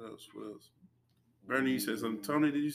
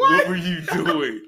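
slow, laughing, near tears; 5 semitones higher, 8 dB louder, slower than usual. Real, not acted. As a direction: ask it while cracking up. Laughing at a video.

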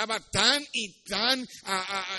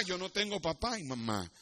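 soft, humble reply; subdued orphan; 9 dB quieter than usual. Acted. Orphan boy admits to the king he has no parents, quietly. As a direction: drop the volume for the child's answer.

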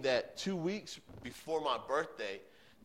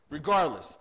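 one word hit much louder, falling firmly; emphatic repeat; 2 semitones higher, 9 dB louder than usual. Acted. God pressing Kerry to love his father: loud emphatic Regardless. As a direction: land Regardless. hard and final.

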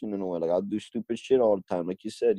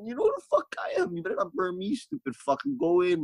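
much higher pitched, hammed-up tough-guy warning; mock angry threat. Warning surfers who pretend not to know him, hammed-up threat. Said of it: "I'm like".

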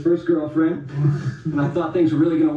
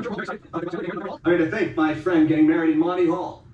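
ordinary delivery

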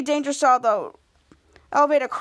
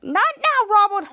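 high, louder acted exasperation; exasperated; 7 semitones higher, 4 dB louder than usual. Kid Danger refuses Robert's selfie request, exasperated. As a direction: shut him down, pitched way up.

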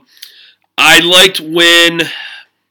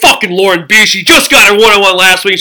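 annoyed; 5 semitones higher, 6 dB louder than usual. Retold or imitated. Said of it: "all the girls were like".

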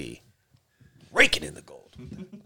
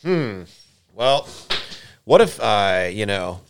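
mock musing woman; 6 semitones higher, 7 dB louder than usual. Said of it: "I've never been like".

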